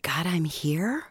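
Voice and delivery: slow unvoiced ghost whisper; ghostly whisper. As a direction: rasp it like the ghost. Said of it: "this is a little bit more whispery".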